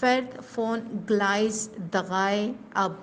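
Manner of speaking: very slow, careful German; deliberate German demo sentence; slow model sentence. Shown as a demonstration. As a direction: read it out slowly for learners. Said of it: "sentence اس کا ہے".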